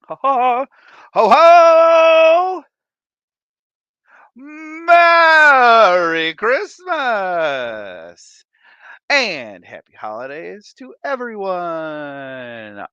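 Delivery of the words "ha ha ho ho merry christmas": loud, high, drawn-out Santa ho ho; hammed-up jolly Santa greeting; Santa laugh.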